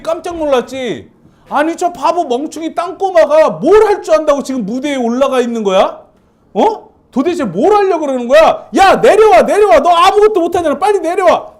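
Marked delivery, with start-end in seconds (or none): 1.5-11.5 loud mocking shout from the crowd, higher; jeering shout. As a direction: yell at him to get off the stage. Crowd jeering the boy on stage. (야유하며 크게 외치는 소리)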